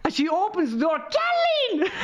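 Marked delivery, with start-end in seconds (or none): 1.0-1.9 high-pitched mock yell; imitated shouting. Perla's mother opens the door, imitated in a high shout. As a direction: screech the name in mimicry. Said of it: "she just started shouting my name".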